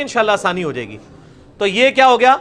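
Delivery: ordinary talk, nothing marked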